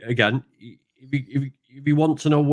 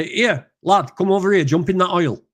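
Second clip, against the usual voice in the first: Yorkshire workmate; 8 semitones higher than usual. Retold or imitated. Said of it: "this lad says".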